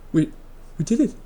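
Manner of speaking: high, soft, disbelieving; whisper-small mock celebration; tentative, high-pitched little triumph; acted hushed amazement. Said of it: "looked at each other like".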